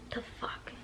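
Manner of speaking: whispered, under-the-breath disbelief; stunned whisper. Retold or imitated. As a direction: mouth it almost without voice. Retold stunned inner reaction.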